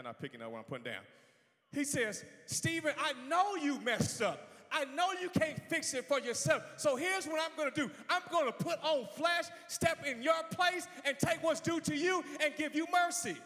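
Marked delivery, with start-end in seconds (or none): 1.7-13.4 high, loud declaration in God's words; full-force preaching. Real, not acted. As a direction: preach it out, stretching the key words.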